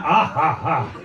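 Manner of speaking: mock laugh, ha ha; imitated laugh. Comic mimicking the masked man's laugh, mock laugh. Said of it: "He only laughs like that".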